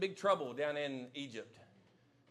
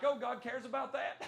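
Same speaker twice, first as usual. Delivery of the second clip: mock surprised; 6 semitones higher than usual. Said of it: "Some of you in here are like".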